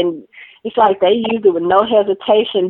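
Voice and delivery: ordinary delivery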